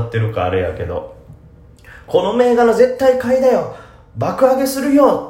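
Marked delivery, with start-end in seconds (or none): 2.1-5.2 much higher and louder, eager standard-Japanese tip; standard-Japanese hype. Retold or imitated. Podcaster mimics a standard-speech poster, high and loud. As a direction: pitch the stock brightly without dialect. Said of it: "っていうよりも本当に".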